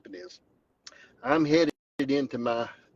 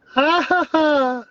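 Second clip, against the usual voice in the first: big high burst of laughter, much louder; loud triumphant laugh; 13 semitones higher, 12 dB louder, slower than usual. Real, not acted. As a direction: cackle with relief and glee. Gloating after dog not seen.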